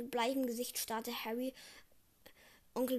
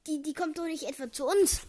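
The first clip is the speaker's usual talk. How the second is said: alarmed blurt; 4 semitones higher, 7 dB louder than usual. A narrator's reading. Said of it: "sprudelte aus ihm heraus".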